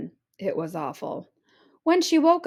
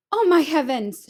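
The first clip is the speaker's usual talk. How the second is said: loud, high cry of alarm; 6 semitones higher, 6 dB louder than usual. Acted. Mother crying out in alarm at her striped daughter. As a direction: gasp it out like a shocked mother. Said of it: "she cried".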